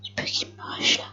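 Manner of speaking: barely audible unvoiced whisper; tense hushed whisper; whispered fear. Real, not acted. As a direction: hush it so the enemy won't hear. Hiding from enemy player.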